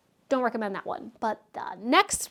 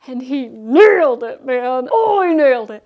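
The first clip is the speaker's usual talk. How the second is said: much louder, thrilled praise; excited gushing; 12 dB louder than usual. Real, not acted. Reviewer raving to viewers about the actor's accent, loud and thrilled. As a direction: shout your delight at the performance.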